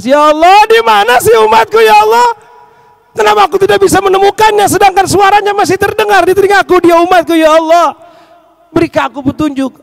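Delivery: high, loud anguished appeal to God; acted tearful pleading. Weeping Prophet begs Allah to find his follower, acted anguished plea. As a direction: cry out in despair, easing off at the end. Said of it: "Rasul nangis".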